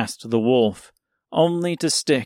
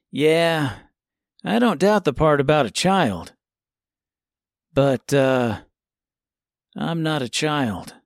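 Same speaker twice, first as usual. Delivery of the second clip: put-on smug boy voice; 3 semitones higher than usual. A narrator's reading. Tip retorts.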